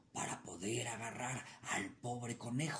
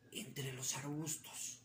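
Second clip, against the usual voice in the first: hushed whisper; 8 dB quieter than usual. A narrator's reading. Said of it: "coyote hiding, whispered".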